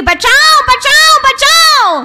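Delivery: high, loud shouts for help; mother-in-law faking drowning, shouting loud; cry for help. Acted. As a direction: scream as if drowning. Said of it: "जोर जोर से चिल्लाने लगी".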